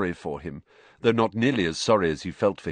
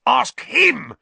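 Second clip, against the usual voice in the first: very high, loud accusing shriek; 8 dB louder than usual. A narrator's reading. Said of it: "shrieked Filch".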